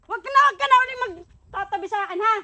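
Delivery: rising loud scolding outburst; building to a loud tantrum; angry. Acted. Husband angry, scolding wife.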